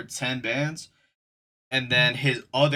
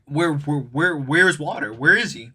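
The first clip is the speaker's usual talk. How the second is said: louder, stuttering demand; mock-agitated manager firing repeated questions; insistent questioning; 3 semitones higher, 6 dB louder than usual. Managers asking him where Water is, mock-insistent and louder.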